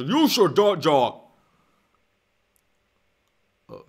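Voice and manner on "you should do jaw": loud, put-on blubbery alien voice; hammed-up alien creature voice; Boss Nass. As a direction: bluster it like a cartoon ruler. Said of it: "regular Boston ass".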